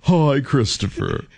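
a lightly put-on neighbour's voice, slow; character voice. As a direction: greet him like a sweet old neighbour. Comic voice greeting Christopher.

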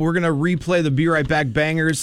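upbeat radio DJ read. Acted. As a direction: hype it like a morning DJ. Radio host back-announce.

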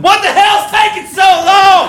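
high, slow angry shout at the mechanic; impatient shout. Retold or imitated. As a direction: yell like a racer who can't wait. Impatient racer at Jiffy Lube.

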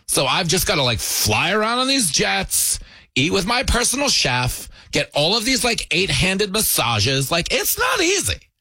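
hammed-up pampered royal whine; mock spoiled princess. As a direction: play the spoiled princess moaning about luxury. Mocking Meghan's hard life.

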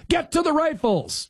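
high pitched mock shout of the order; mock yell. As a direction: yell it like the leader giving an order. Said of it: "had yelled".

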